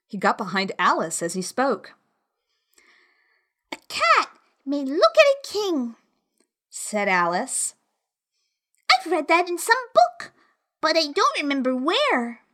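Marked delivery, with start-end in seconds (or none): 3.7-12.4 put-on high, pert little girl; young girl voice. A narrator's reading. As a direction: answer back cheekily. Alice answers the king.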